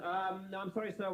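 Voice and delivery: ordinary delivery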